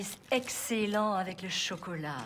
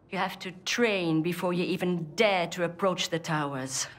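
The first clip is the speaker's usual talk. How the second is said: ordinary talk, nothing marked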